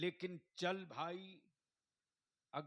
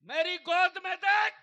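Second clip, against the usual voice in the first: much higher, very loud command; thundering preacher's shout; 20 dB louder than usual. Acted. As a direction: shout each word out as the Kaaba's command. Preacher repeats quote at full force. (गरजती ऊँची आवाज़ में हुक्म)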